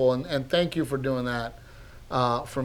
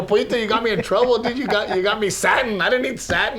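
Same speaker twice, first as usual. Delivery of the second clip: higher-pitched playful griping; mock complaint; 8 semitones higher, 4 dB louder than usual. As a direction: grumble at him in jest, pitch up. Retelling his mock complaint to Pollito.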